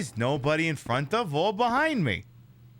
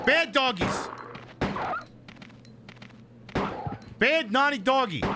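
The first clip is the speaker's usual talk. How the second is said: scolding dogs; 10 semitones higher than usual. Pretend. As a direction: tell off naughty dogs in a high voice.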